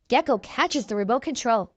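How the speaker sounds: plain reading voice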